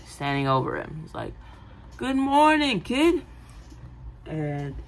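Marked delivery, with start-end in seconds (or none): 2.0-3.2 higher, breezy greeting; lightly voiced friendly stranger; a hint of a cheerful alien. Day greeting Richard.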